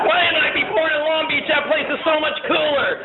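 high-pitched mock kid voice; complaining daughter. Daughter griping to dad about Lompoc, high complaint. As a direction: put on a high child's protest.

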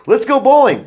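high, loud and bright, selling the idea; eager suggestion. Retold or imitated. Dad eagerly suggesting bowling to the family, high and loud. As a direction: pitch it like a fun family outing.

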